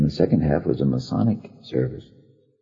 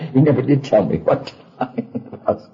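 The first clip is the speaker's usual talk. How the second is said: usual speaking voice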